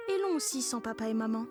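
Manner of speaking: normal talking voice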